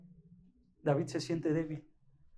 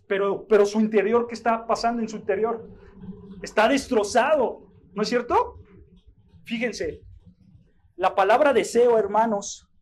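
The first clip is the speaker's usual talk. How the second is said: high, loud, fervent preaching; preaching at full force; 4 semitones higher, 7 dB louder than usual. Acted. Pastor telling brothers David was sick, full-force preaching. Preacher asks about inner state.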